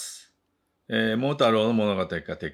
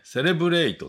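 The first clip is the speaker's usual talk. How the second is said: "セレブレイト" with even Japanese syllables; katakana English; 10 semitones higher, 4 dB louder than usual. Shown as a demonstration. Teacher citing the familiar word, katakana English pronunciation. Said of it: "セレブレイトってもうなんかね".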